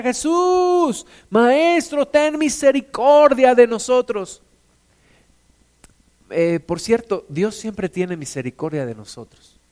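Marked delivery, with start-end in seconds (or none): 0.0-4.4 high pleading call, loud on the first word; shouting plea. A narrator's reading. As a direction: cry out for mercy from far away. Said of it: "le empiezan a gritar".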